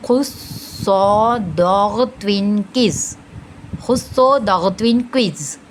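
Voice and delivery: "खुस्सो" with a held "s" and rounded "o"; heavy-letter mnemonic. Shown as a demonstration. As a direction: recite "खुस्सो दगत्विन किस" with full heavy letters. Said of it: "इस तरह से हम heavy letters को अदा करेंगे".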